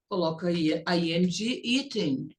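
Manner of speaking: ordinary talk, nothing marked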